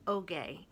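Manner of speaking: Ogay, the g voiced like in go; name Ogay. Shown as a demonstration. Reader shows how the author says Ogay. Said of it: "find out how to pronounce".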